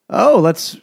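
hammed-up greedy partner; greedy dad. Retelling the father's greed to friends, mock eager. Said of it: "his dad was like".

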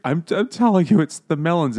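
put-on high, loud pleading kid; mock desperate insistence. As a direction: insist like a kid nobody believes. Voices kid insisting to parents.

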